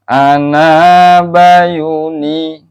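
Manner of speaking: Ana bayuni very slow, with a push on the syllable yuni; chanted with emphasis on yuni; stress demo. Shown as a demonstration. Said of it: "ada tekanan".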